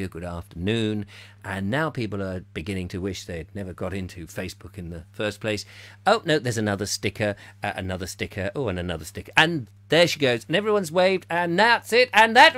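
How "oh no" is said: ordinary talk, nothing marked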